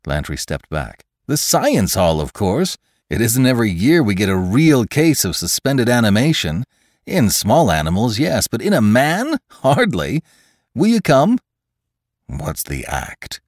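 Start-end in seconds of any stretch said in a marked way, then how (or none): 1.3-11.4 high, lilting enthusiasm; eager scientist McClure. Acted. Scientist urges a rare case to the hall, eager and high. 12.3-13.4 low, soft, slow challenge; suspicious demand. A narrator's reading. Lantry glares at the friendly scientist, demanding low and slow. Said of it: "demanded Lantry, glaring".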